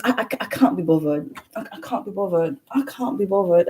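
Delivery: imitating a posh British friend; softly put-on British accent, dismissive; friend's British accent. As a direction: mimic a British friend's catchphrase. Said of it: "I always emulate her".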